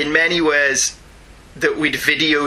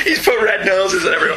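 laughing; 4 semitones higher than usual. Real, not acted. Laughing reply to joke.

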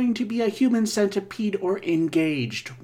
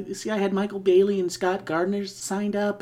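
normal talking voice

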